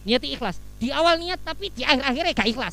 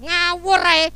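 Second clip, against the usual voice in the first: higher and louder, offended outburst; indignant retort; 7 semitones higher, 9 dB louder than usual. Retold or imitated. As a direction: snap back like a man who feels wronged. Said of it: "Terus ngomong".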